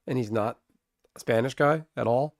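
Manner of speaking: normal talking voice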